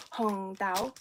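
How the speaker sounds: "Hong dao" said as Cantonese, hong then dao rising; Cantonese pronunciation. Shown as a demonstration. Teaching viewers the Cantonese for red bean, "Hong dao" with a rising tone. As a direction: say "Hong dao" like a Cantonese speaker, tune lifting on dao. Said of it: "Hong is red, dao is bean".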